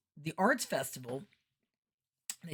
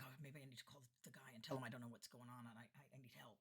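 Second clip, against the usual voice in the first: low, very quiet worried muttering; hushed freaking out; 4 semitones lower, 21 dB quieter, faster than usual. Retold or imitated. Freaking-out entertainer talking to herself, low and hushed. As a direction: whisper the retold worry to yourself. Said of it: "so I started freaking out".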